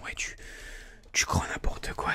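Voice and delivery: ordinary talk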